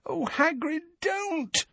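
very high, soft moan; moaning plea. A narrator's reading. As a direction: plead in a small, anxious moan. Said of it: "moaned Hermione".

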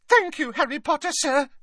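very high squeaky house-elf voice; tiny, high-pitched happy servant; happy squeak. A narrator's reading. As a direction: squeak it with delighted gratitude. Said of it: "squeaked Dobby happily".

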